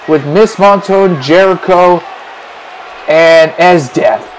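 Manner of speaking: usual speaking voice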